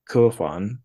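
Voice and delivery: ordinary talk, nothing marked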